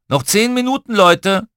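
high, loud parental announcement; calling out. A narrator's reading. Dad below calls up to groaning children, loud call. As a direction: give the kids a loud warning.

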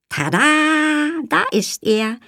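showman's high ta-da. Acted. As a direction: present it like a magic trick. Lilly presents Bonsai like magic.

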